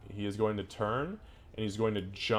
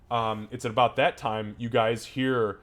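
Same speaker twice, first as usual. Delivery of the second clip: ordinary talk, nothing marked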